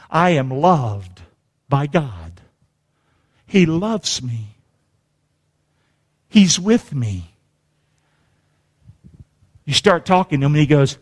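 normal talking voice

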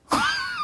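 very high, brief exasperated squeal. Acted. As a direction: squeal briefly at the top of your voice. Exasperated noise after silly question.

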